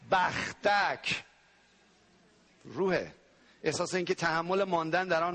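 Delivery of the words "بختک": بختک stretched very slowly; drawn-out word. Shown as a demonstration. Preacher naming the nightmare spirit to Iranians, long drawn word. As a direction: hold بختک out long and heavy.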